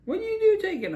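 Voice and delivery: high angry parent yell. Retold or imitated. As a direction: yell it like a furious dad. Father yelling at him.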